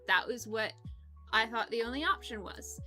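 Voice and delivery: normal talking voice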